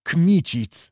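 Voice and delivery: high, sudden cry of the name; startled exclamation. A narrator's reading. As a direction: exclaim it in shocked recognition. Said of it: "wykrzyknął pan Wołodyjowski".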